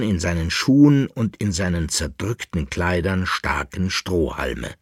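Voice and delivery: ordinary delivery, nothing marked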